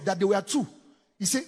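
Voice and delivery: ordinary talk, nothing marked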